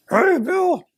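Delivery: high, far-off trumpeting call; a mammoth saying hello, way up high; woolly mammoth impression. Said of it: "my woolly, woolly mammoth impression".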